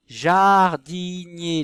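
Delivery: JARDINIER very slow and loud, JA held long; drawn-out word demo. Shown as a demonstration. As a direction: stretch every syllable of JARDINIER. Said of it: "Est-ce qu'on entend la syllabe ja".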